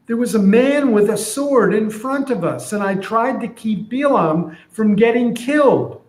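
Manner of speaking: donkey defending what it did; earnest donkey. Retold or imitated. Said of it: "So here's what I'm imagining".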